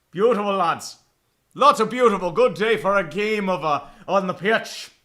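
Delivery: loud, hammed-up Irish welcome; bad Irish accent. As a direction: put on a shaky-at-best Irish brogue like a match host. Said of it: "a shitty Irish accent".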